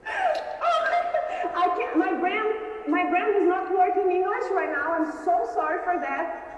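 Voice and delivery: high, loud, laughing and flustered; overwhelmed with joy. Real, not acted. Winner overwhelmed on hearing her name, joyful. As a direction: gasp it out, too thrilled to think. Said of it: "I'm feeling happy and emotional".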